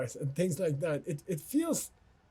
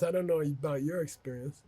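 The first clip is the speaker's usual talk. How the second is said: ordinary talk, nothing marked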